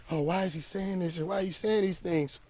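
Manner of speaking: soft, high mock-hurt voice; mock sad. Followers upset by the message, mock sad. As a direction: imitate hurt people, high and quiet. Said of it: "People was getting sad and like".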